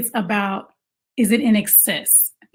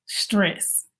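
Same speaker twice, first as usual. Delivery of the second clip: weary breath; 7 dB quieter than usual. Acted. One-word sigh after child trouble.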